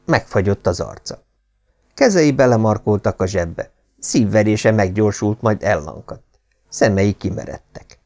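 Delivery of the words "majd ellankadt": usual speaking voice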